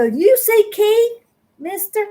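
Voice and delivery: high, slow call. Retold or imitated. Mother asks her sons where her husband is, high slow call. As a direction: stretch it out, calling to the boys.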